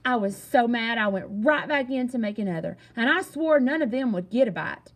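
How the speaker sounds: ordinary delivery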